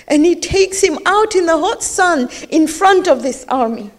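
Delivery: ordinary delivery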